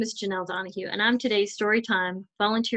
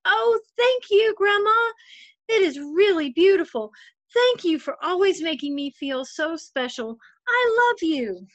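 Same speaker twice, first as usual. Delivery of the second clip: put-on much higher, childlike, grateful thanks; young girl's voice; 9 semitones higher, 4 dB louder than usual. Girl thanking her grandma for the bow, high childlike gratitude. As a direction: become the little girl hugging her grandma. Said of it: "big hug and said".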